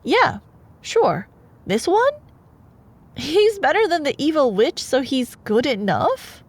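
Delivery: high, loud airheaded mock voice; imitating Rapunzel's easy acceptance. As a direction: parody her easy yes. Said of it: "she's just like".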